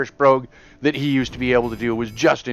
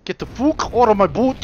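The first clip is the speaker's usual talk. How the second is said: line redone in a light Scottish accent, a bit higher; 7 semitones higher than usual. Shown as a demonstration. Correcting his friend's attempt with a lightly Scottish accent. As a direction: show him how the Scottish version sounds. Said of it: "That, that would be Scottish".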